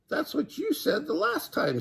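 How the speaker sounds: high snickering jab; snickered. A narrator's reading. Nightingale mocking departing Jackal, high snicker. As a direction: sneer it high. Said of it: "snickered Nightingale".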